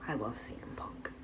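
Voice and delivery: whispered, breathless confession of love; hushed whisper, sharing a secret; secretive whisper. Retold or imitated. Secret line from Roman Reigns.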